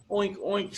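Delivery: high mock pig oinks; oinking pigs. Pigs answer the duck with high oinks.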